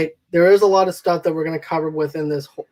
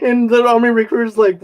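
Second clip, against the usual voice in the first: usual speaking voice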